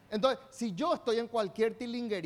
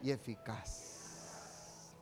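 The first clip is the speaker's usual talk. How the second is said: whispered ending; 10 semitones lower, 11 dB quieter, much slower than usual. Shown as a demonstration. Preacher ending the chant in a hushed whisper. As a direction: breathe "y eficaz" out very low, almost silent. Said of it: "trailing whisper".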